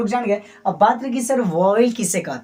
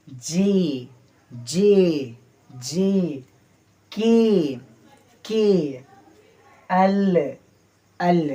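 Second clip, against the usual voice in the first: normal talking voice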